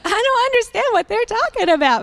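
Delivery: high, laughing bewilderment; amused disbelief through laughter; laughing confusion. Retold or imitated. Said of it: "I'm like".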